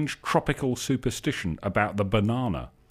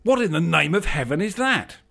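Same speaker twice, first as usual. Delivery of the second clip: alarmed; 7 semitones higher, 4 dB louder than usual. A narrator's reading. Istvan alarmed by machine.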